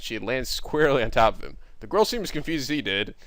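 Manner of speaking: ordinary delivery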